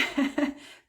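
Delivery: short breathy chuckle; quick self-deprecating laugh; amused laugh. Real, not acted. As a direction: laugh lightly at your own admission. Amused at her own admission.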